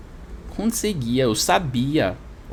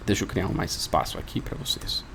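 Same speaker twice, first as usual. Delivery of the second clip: muttered aside; 5 semitones lower, 8 dB quieter than usual. Real, not acted. Muttered aside while editing.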